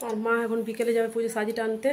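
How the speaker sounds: ordinary talk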